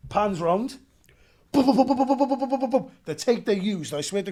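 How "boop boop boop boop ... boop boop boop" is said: loud, high rapid bursts of boops; imitated automatic fire. Acting out the full-auto scene for the host, loud rapid gun noises. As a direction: rattle it off like a machine gun.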